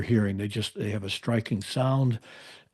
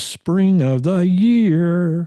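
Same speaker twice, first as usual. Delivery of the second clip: much higher, louder, very slow sung-out phrase; meadowlark song imitation; 8 semitones higher, 6 dB louder, much slower than usual. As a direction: sing it out like a lark greeting spring. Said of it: "the eastern meadowlark says".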